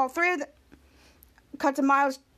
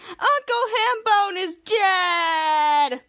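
slow, loud, sobbing wail; 4 semitones higher, 4 dB louder, much slower than usual. Acted. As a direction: draw the news out in a howl of tears. The little sister wailing over her uncle.

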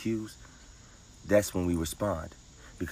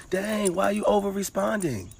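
squeaky, much higher and louder nag; high mocking voice; 5 dB louder than usual. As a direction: mimic a whiny scolder, pitched way up. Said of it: "You never heard nobody say".

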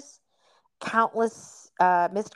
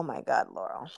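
whispered name; 9 semitones lower, 6 dB quieter than usual. Real, not acted. Aside to friend about shocking image.

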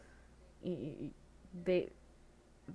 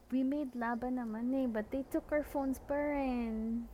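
put-on higher, slow Taglish accent; bougie Taglish accent; 7 semitones higher than usual. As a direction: drawl like a posh Manila student. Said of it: "it was a different accent".

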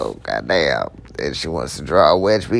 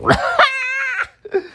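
a sudden high whooping laugh. Real, not acted. Laughs at his own joke.

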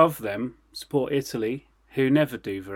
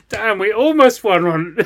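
mock fan voice; 8 semitones higher, 8 dB louder than usual. Mocking Cyprus fans' voice.

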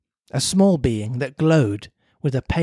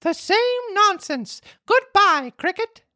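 put-on high puppet boy voice; Pinocchio voice; slower than usual. Pinocchio dismisses the cricket.

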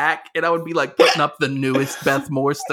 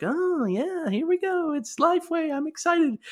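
high, put-on excited voice, softer; very peppy; 13 semitones higher, 3 dB quieter than usual. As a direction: pipe it up with mock pep. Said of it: "Like, very peppy, like".

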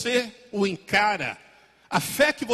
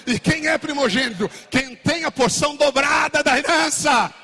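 higher, louder declaration; preaching shout; 8 semitones higher, 7 dB louder than usual. Real, not acted. Preacher declares to the church, shouting. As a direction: proclaim it at full force.

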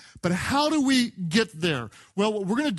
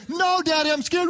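put-on high, whiny kid voice, a bit louder; scared little boy; 9 semitones higher, 3 dB louder than usual. Dad retelling his son's fear on the four-wheeler, high kid voice. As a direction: squeal it like a frightened small boy. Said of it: "I said, and then".

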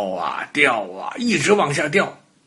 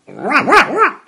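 high, loud imitated yaps; little dog barking; 12 semitones higher, 6 dB louder than usual. A narrator's reading. Little dog calls to ox, horse and goat, high barking. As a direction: yap like an excited small dog. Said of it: "对他们说".

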